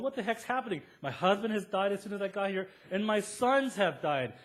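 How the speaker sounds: high, loud, frantic cry of disbelief, with a laugh; distraught mother-in-law. Retold or imitated. Preacher voicing the widowed mother-in-law, high and loud dismay. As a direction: throw up your hands as the grieving mother-in-law. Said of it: "this mother-in-law is going".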